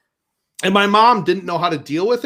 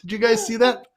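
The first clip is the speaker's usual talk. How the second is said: higher, laughing mock fumble; mock whoops; 7 semitones higher, 4 dB louder, slower than usual. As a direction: fake an accident, hammed-up. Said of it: "start mocking her, mocking him".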